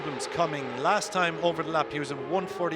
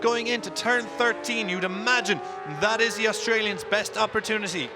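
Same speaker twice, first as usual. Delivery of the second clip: motorsport commentator; 5 semitones higher than usual. Real, not acted. Live race call, final lap.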